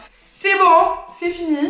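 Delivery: high, loud "c'est bon, c'est fini"; emphatic declaration. Real, not acted. Telling viewers no man can hurt her now, high and loud. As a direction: slam the door on it, up high.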